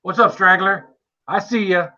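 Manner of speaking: usual speaking voice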